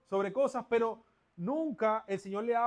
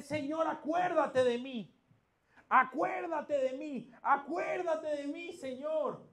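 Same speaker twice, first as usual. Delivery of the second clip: pleading cry; 7 semitones higher, 4 dB louder, slower than usual. Acted. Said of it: "Él le dice".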